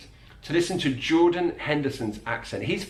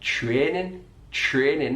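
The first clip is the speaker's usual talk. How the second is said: training with a flat eh vowel; Mackem training; slower than usual. Shown as a demonstration. Teacher showing the Sunderland flat vowel in training. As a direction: say training with one steady eh, Mackem style. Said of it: "not training".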